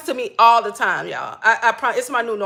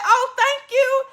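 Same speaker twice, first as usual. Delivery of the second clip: delighted thanks; 10 semitones higher, slower than usual. Retold or imitated. Grateful surprise at money.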